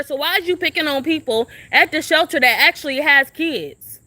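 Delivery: normal talking voice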